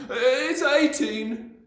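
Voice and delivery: high, slow panicky correction; anxious cry. A narrator's reading. Boy hastily correcting himself, panicky. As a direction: cry out the new answer. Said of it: "he cried".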